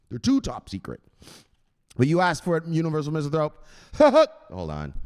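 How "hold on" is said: ordinary talk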